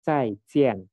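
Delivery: "Zaijian" as two firm falls; fourth tone. Shown as a demonstration. Teacher ends with the farewell, falling "Zaijian". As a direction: drop each syllable of "Zaijian". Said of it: "Jadi dihentakan".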